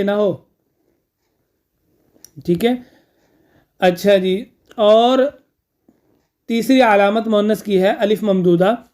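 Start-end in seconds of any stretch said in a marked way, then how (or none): none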